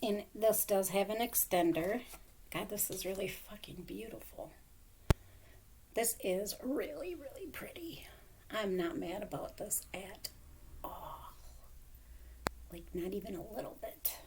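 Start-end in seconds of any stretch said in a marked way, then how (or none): 2.5-14.1 hushed, soft and breathy wonder; awed whisper. Real, not acted. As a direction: murmur it in quiet awe. Hushed awe over necklace.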